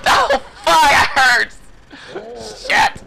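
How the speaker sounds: high yelled curse, breaking into a cry; pained yell. Real, not acted. Player crying out after being stabbed. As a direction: cry out from the hurt. Just got hit in game.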